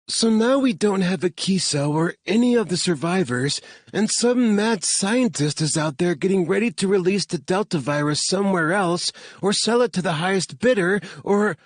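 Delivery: high, panicked woman's rant; panic attack. A narrator's reading. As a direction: spiral into panic, pitched far up. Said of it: "you're having a panic attack".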